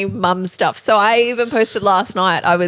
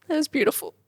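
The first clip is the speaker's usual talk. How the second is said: teary; 7 semitones higher, 6 dB quieter, faster than usual. Real, not acted. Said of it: "You feel all the emotions?".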